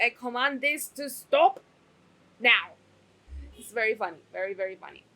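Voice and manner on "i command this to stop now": mock royal order, much louder on now; booming command. Fan imitating the queen, booming. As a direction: bellow the last word like a queen. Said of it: "It's like".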